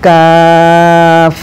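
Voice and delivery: কাফ said light and thin, held very long; কাফ হালকা করে টেনে; light drawn kaf. Shown as a demonstration. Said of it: "একদম হালকাভাবে বলবেন".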